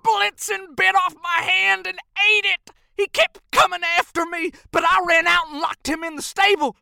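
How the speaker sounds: high, loud, urgent elf voice; angry growl. Acted. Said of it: "Crandall growled".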